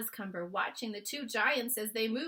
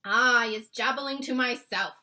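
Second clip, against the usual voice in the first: a touch of a louder giant voice; lightly voiced friendly giant; BFG answering; 5 semitones higher, 11 dB louder than usual. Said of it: "the B F G answered".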